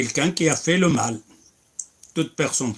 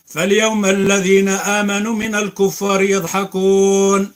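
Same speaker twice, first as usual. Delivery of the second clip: fast, raised chanted recitation of the verses; louder melodic tajwid recitation; Quran recitation; 6 semitones higher, 7 dB louder, much faster than usual. Acted. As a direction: recite like the imam, quick and melodic.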